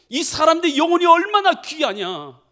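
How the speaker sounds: high, loud swell of feeling; impassioned appeal. Acted. God pleads for the people's souls, passionately. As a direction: cry out the question with urgency.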